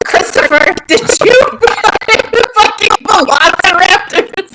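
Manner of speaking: high, drawn-out incredulous demand through laughter; laughing accusation. Real, not acted. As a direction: accuse him while cracking up. Mock scolding the game master, laughing.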